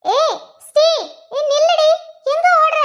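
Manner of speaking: high, urgent call to stop; calling after someone. Acted. Calling after girl running away.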